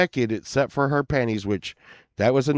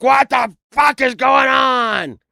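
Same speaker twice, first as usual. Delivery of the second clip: much higher, louder, slow shout; retold shout; 10 dB louder than usual. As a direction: yell it in shocked outrage. Retold yelling at drummer.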